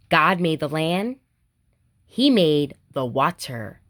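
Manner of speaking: put-on reading to children. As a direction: read it gently to little kids, each line a small wonder. Reading picture books aloud.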